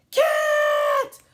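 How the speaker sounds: sudden loud high yelp of "Cat!"; eager dog's shout; excited shout. Acted. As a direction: bark it out like a dog spotting a cat. Dog barking at the cat.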